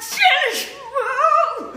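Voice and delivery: hammed-up old geezer, very slow and high; old man. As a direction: stretch it out like a comic old man. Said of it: "impression is an old man".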